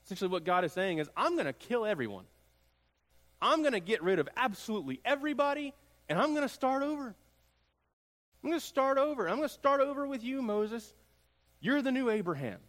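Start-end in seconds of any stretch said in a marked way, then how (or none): 1.2-12.6 much higher, louder, fast wrathful declaration; preacher half-acting God's fury; voicing furious God. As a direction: rail like an exasperated God who wants to start over.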